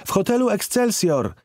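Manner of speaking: put-on high child voice shouting back; girl calling back. A narrator's reading. Girl shouts back from the road, in a high child's voice. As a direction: call back without turning. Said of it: "odkrzyknęła".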